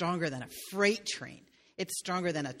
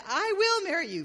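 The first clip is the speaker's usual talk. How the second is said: put-on girl's acceptance; 11 semitones higher, 3 dB louder than usual. Girl accepts the king.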